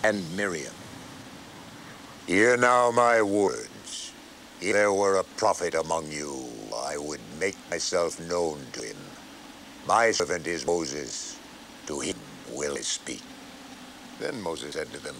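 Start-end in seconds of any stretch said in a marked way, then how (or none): 2.2-13.2 voice of the Lord. A narrator's reading. The Lord speaks suddenly.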